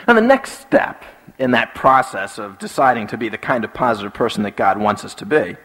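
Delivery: ordinary talk, nothing marked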